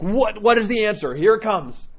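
high-pitched, expectant excitement; eager anticipation. Retold or imitated. The speaker awaiting the monk's grand wisdom, eager anticipation. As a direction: await the big answer, voice lifted high.

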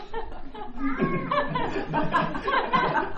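long high-pitched laugh; real laughing fit. Real, not acted. Storyteller cracking up at the monk's punchline, real laughter. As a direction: burst out laughing helplessly.

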